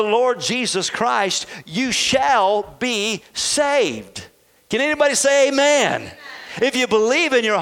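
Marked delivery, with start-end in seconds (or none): 1.6-4.3 drawn out. Real, not acted. Preacher drawing out the promise. 4.7-6.1 calling out. Real, not acted. Preacher calling out to congregation.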